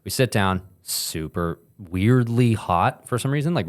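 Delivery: usual speaking voice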